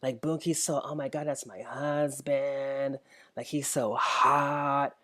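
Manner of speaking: a hint of a swooning Instagram fan; mocking fangirl. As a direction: mimic a gushing fan, drawn out and breathy-soft. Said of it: "chicks that are like".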